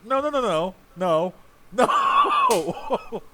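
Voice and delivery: rising to a long, very high wail; hammed-up, high-pitched "no" of disbelief; mock outraged protest. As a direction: escalate the refusals into a drawn-out cry. Mock outrage at app's count.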